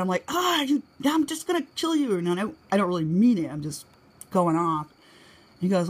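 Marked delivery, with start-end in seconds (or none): 0.3-2.2 high-pitched, playful exasperated threat; mock mad. Wife mad at husband, imitated harmless outburst. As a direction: threaten him without meaning it, high and worked up. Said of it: "if I get mad at him".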